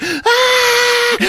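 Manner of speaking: long, very high shriek; mock terrified scream. Girl about to hit the mirror, mock scream.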